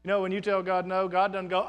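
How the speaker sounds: ordinary delivery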